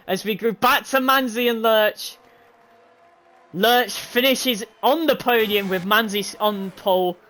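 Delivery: normal talking voice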